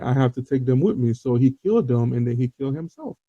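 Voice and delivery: ordinary delivery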